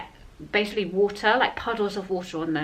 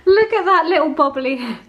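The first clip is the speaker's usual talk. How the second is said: delighted cooing; 7 semitones higher, 8 dB louder than usual. Real, not acted. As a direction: gush at the little head. Cooing over baby's head.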